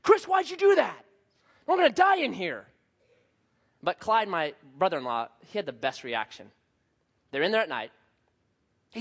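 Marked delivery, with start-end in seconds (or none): 0.0-2.7 high, loud, accusing yell; angry, raised-pitch outburst; mad. Retold or imitated. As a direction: imitate a panicked buddy lashing out. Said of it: "he got mad".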